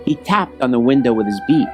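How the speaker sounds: usual speaking voice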